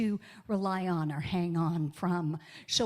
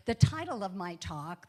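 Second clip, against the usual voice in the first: ordinary talk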